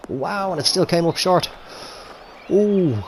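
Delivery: high loud wow of disbelief; shocked at the short shot; surprised. Real, not acted.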